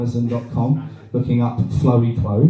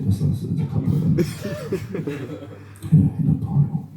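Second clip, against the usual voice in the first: hushed; 5 semitones higher than usual. Acted. Sad cheeseburger eating, hushed.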